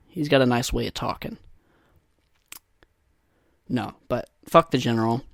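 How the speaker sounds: ordinary talk, nothing marked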